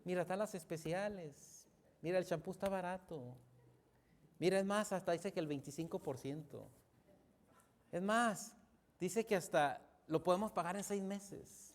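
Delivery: soft, coaxing wife act; wheedling wife. Acted. Wife asks husband for shopping money, softly acted wheedling. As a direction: coax softly, as a wife asking a favour. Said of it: "cuando la mujer se acerca".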